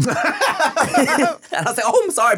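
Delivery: laughing through the words; can't stop laughing while telling it. Real, not acted. Laughing while retelling apology.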